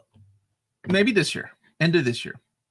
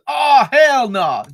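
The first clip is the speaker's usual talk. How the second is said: exclaiming; 8 semitones higher, 11 dB louder, much slower than usual. Retold or imitated. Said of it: "at first, everything was".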